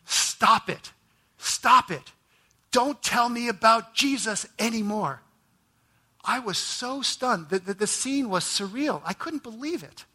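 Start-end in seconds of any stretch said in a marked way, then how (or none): 0.1-5.2 higher, louder mock bellow of a dying man; retold bellow. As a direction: shout him down as the brother did. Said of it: "he bellowed".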